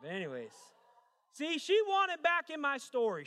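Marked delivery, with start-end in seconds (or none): 1.3-3.2 slow, high, loud proclamation; hammed-up outrage. Real, not acted. Preacher telling congregation about his ex, hammed-up outrage. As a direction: cry it out with comic indignation.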